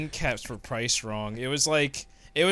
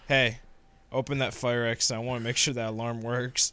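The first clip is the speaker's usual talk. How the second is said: low, softer mock-authority voice; menacing fire marshal; 6 semitones lower, 4 dB quieter than usual. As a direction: play a marshal quietly enjoying his power. Acted fire marshal ordering the manager.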